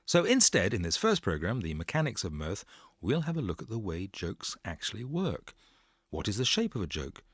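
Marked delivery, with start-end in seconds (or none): none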